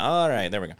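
ordinary delivery

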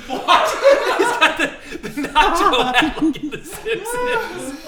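high, slow, laughing hard. Real, not acted. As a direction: wheeze it out laughing. Cracking up over nacho hat.

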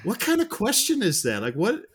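high-pitched mock disbelief; retold baffled outburst; incredulous. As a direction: question it as if it's absurd. Retells own incredulous reaction to film.

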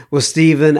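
normal talking voice

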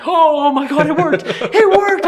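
high, loud elated squeal; overjoyed, voice jumping high; retold excitement, laughing; freaking out. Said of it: "I'm freaking out".

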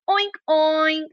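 high double oink; pig oink. Shown as a demonstration. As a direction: imitate a pig for a child. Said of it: "¿cómo hace?". (gruñido de cerdo, agudo)

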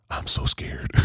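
hushed, whispered confession; scared whisper. Retold or imitated. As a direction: whisper it so nobody nearby hears. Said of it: "you don't wanna disturb anybody".

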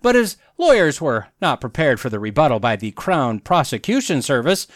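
normal talking voice

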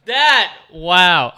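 loud, high startled oh; surprised shout. Real, not acted. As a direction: blurt out a loud wow. Startled reaction to joke.

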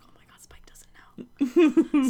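slow, shocked whisper; hushed whisper. Retold or imitated. Frank realizing Spike doesn't know, slow shocked whisper. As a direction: breathe it out in alarm, slow. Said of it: "Frank's just like".

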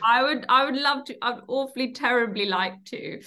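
light mock-posh echo; posh English accent. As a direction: copy the toff voice playfully. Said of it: "echoing the posh voice".

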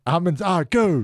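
high, slow announcer call; mock launch call. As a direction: call it like a heroic countdown's end.

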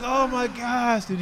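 high, drawn-out mock dismay; acted-out slow, anxious groan of words; retold panic. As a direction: stretch out a worried plea to a friend. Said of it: "a little panic anxiety attack".

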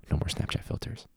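a hushed heartfelt wish; whispered plea. Real, not acted. Teacher wishing the filters away, in a whisper.

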